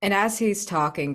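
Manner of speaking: normal talking voice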